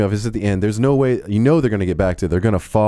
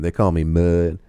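lightly put-on Southern drawl; a mock-cool Southern accent, only a touch; imitating Matthew McConaughey; slower than usual. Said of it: "he has that southern accent".

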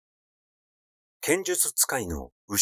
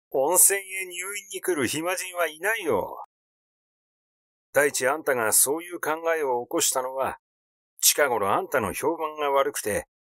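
高めの声で嘲るように; 相手の魂胆を冷やかし暴く; 皮肉屋の画家; 4 semitones higher than usual. Acted. 京六の台詞、高く別人の声. (並木先生をからかう京六、高めの皮肉な声)

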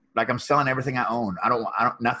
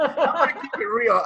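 higher, louder, laughing reply; 11 semitones higher, 5 dB louder than usual. Real, not acted. Answering the host's keep it real, laughing. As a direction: laugh as you agree.

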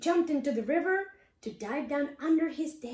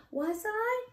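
higher surprised question; 10 semitones higher than usual. Acted. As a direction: ask in disbelief.

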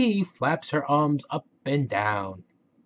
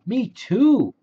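high, loud gleeful shout; excited blast off; 13 semitones higher, 6 dB louder, slower than usual. Acted. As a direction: launch with joy. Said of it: "says Katy Duck".

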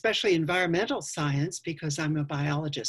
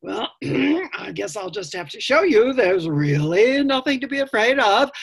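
responsible eldest brother; 6 semitones higher, 7 dB louder than usual. Acted. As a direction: clear the throat and declare it firmly. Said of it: "feeling responsible as the eldest brother".

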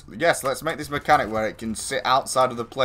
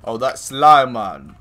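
slow, louder drawn-out catchphrase; impression of a friend; 10 dB louder, much slower than usual. Recalling the catchphrase a friend used to say, drawn out slowly. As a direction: stretch his old catchphrase out slowly. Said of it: "Your impression of Pretzel".

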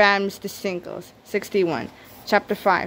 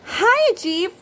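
very high, sing-song hiya; sweet high greeting; 11 semitones higher, 3 dB louder than usual. Acted. Nurse Lady greeting Chief sweetly on their date. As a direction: chirp a flirty hello.

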